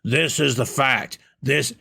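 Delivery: slow, mock-pompous pronouncement; mock know-it-all. Host mocking podcasters who claim facts, slow pompous voice. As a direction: lightly voice a smug know-it-all declaring facts. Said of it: "none of Tom's guests say".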